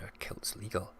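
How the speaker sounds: hushed disbelief. Real, not acted. Streamer echoing chat's question about kilts, hushed disbelief. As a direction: repeat the question in an incredulous whisper.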